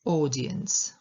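"audience" with a drawn-out AW at the start; long O, first-syllable stress. Shown as a demonstration. Teacher showing learners "audience", long stressed first syllable. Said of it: "akcent na pierwszą sylabę".

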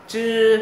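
"chư" held long and high, tongue curled up. Shown as a demonstration. Teacher repeats "chư" for learners, tongue curled. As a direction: curl the tongue back for a drawn-out "chư". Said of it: "lưỡi uốn cong lên".

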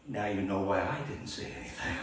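quiet reply. Retold or imitated. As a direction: hush it down, a sly quiet answer. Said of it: "quietly Khrushchev replied".

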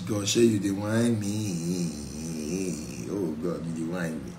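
low, slow, soft whining prayer; mock lament to God. Believer whining low and slow to God. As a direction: drag it out like a sulking complaint.

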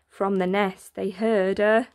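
ordinary delivery, nothing marked

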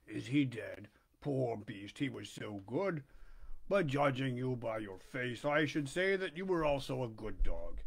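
slow, low sly critter; low polecat voice. Acted.

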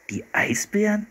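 high, incredulous question; can't believe it's polar bears; disbelieving. Acted. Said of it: "wiederholte Philipp ungläubig".

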